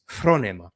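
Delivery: phronema as FRO-neh-ma; proper Greek pronunciation. Shown as a demonstration. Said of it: "do the right pronunciation".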